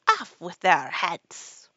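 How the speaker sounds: loud, stretched command; royal death order. A narrator's reading. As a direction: shout the sentence like a merciless queen. Queen orders execution.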